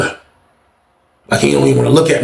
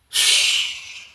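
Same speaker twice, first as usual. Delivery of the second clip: breathy shush, nearly silent; soft shushing; 7 dB quieter than usual. Retold or imitated. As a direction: hush someone so guards won't hear. Hushing during lockdown night.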